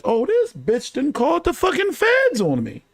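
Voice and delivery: high, stunned disbelief; retelling his shocked reaction. Retold or imitated. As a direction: voice jumps up in shock. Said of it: "I'm looking and I'm like".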